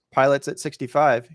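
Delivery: ordinary delivery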